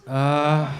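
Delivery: ordinary talk, nothing marked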